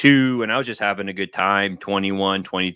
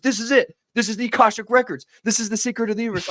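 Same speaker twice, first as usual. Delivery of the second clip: high, thrilled rush of a discovery; imitating an overexcited buddy; excited friend; 11 semitones higher, faster than usual. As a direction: burst in breathless with big news. Friend shows the Akashic Records.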